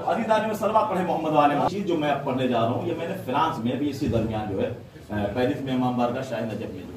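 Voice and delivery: ordinary delivery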